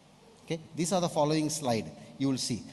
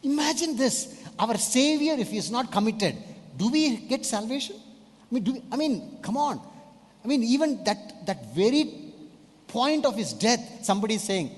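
fiery preaching; 8 semitones higher than usual. Acted. Pastor urging the congregation about Jesus' commitment, in fiery preaching. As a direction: drive the point home at full force.

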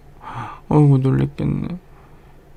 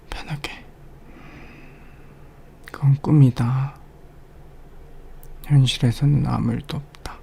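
ASMR whisper; 2 semitones higher, 7 dB quieter than usual. Acted. Whispering to a partner after a nightmare, lulling. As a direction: whisper them back to calm. Soothing partner back to sleep.

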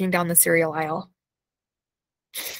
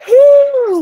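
releasing exhale; 12 dB louder than usual. Real, not acted. Releasing energy after tapping.

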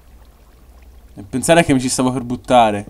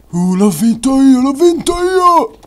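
triumphant; 13 semitones higher, 7 dB louder, slower than usual. Real, not acted. Victory celebration.

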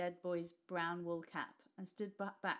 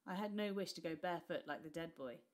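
plain reading voice

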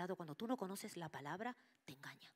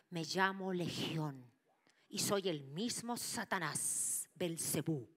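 slow, louder demonic declaration; 4 dB louder, slower than usual. Retold or imitated. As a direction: announce the name like a demon. Demon reveals its name.

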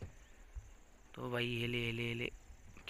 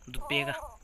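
put-on baby talk. Offering milk to the game baby.

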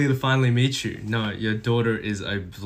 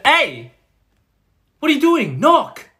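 high, loud scolding shout; angry yell; 10 dB louder, slower than usual. Acted. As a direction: yell at someone barging in. Yells at someone barging in.

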